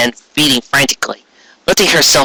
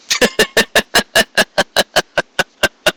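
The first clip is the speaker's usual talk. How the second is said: a higher, amused villain chuckle; Corona's cold laugh; 9 semitones higher than usual. A narrator's reading. Tyrant queen laughing at ponies, mock chuckle. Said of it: "Corona mused aloud".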